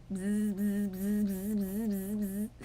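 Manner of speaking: imitated buzz of hair clippers; mimicking clippers shaving the dad's head. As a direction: buzz like the razor going over his head.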